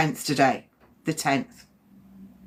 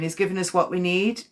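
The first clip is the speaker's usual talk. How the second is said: ordinary talk, nothing marked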